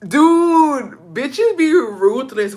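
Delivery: loud, high, drawn-out exclamation; scandalized. Acted. Reacting to the rude driver's comment, scandalized. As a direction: exclaim slowly in mock shock.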